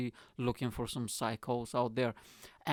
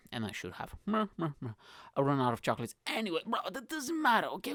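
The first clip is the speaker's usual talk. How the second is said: normal talking voice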